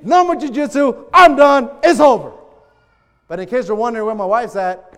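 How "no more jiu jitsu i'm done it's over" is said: loud, emphatic acted ultimatum; upset outburst. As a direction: punch out each short declaration angrily. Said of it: "I just got upset".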